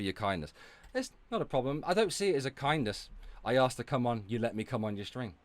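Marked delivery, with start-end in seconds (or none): none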